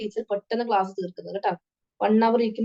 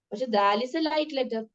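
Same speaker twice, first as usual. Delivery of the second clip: ordinary talk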